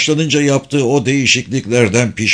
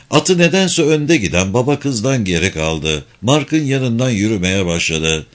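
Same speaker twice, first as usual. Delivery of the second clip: ordinary delivery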